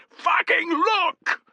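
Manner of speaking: high, loud, slow drawn-out yell; parade ground shout. Acted. Centurion yelling at his men to look up. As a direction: roar it at the whole cohort. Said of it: "parade ground voice".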